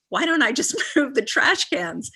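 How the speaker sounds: high, laughing mid-question; mock the dawning of an obvious fix; laughing realization. Woman laughing at her own trash cans, high and amused. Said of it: "And she said".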